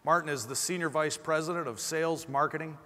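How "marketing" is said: ordinary delivery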